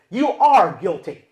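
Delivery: loud, emphatic accusation; accusing spouse. Acted. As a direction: pronounce the verdict loudly at them. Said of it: "look at you and say".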